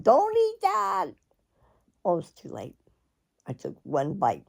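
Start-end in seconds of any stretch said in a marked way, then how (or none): 0.0-1.1 high, slow mock warning in a put-on voice; husband's warning. Husband warns his wife off the spicy gumbo, high and slow. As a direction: hams up his alarmed warning, drawn out high. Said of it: "the first thing he said".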